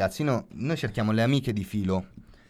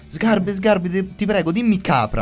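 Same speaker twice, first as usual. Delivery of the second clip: higher, louder begging fan voice; pleading fan; 7 semitones higher, 3 dB louder than usual. Retold or imitated. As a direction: beg him like an eager fan. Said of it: "dei ragazzi incontrano Sgarbi e dicono".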